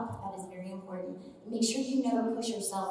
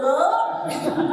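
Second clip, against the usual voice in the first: loud laugh; 7 semitones higher, 8 dB louder than usual. Real, not acted. Laughs after the yell.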